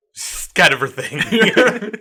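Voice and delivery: said through a laugh, slow; chuckling out a dry joke; amused, laughing aside. Real, not acted. Laughing while joking.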